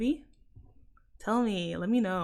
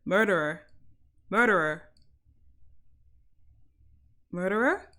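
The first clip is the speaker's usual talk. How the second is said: American "Murderer" with hard Rs; attempted American accent; 3 semitones lower than usual. Shown as a demonstration. Londoner trying a word for viewers, attempted American accent. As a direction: say "Murderer" with every R sounded, American-style. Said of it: "in an American accent".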